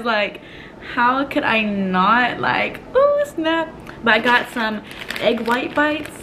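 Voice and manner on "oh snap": mock surprise. Real, not acted. Self-admiring exclamation.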